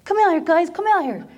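high, loud call to the back. Retold or imitated. Counter guy calling cooks from the kitchen, loud and high. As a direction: yell for the cooks to come see. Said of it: "he calls the guys".

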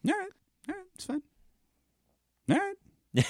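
a defeated, sing-song all right; grudging concession. Real, not acted. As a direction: give in with mock reluctance. Conceding after being told wrong.

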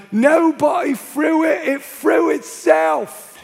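loud, high, drawn-out Cockney holler; shouted Cockney. Retold or imitated. Cockney heckler at the back shouts to the speaker, mocking Cockney shout. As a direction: yell it across a crowd in broad Cockney. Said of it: "just shouted".

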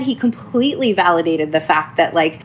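normal talking voice

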